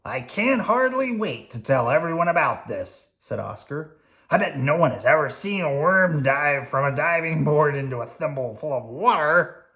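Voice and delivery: gruff grouchy Oscar; grumpy garbage-can grouch. Acted. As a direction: growl it like a grouch. Oscar voiced, excited.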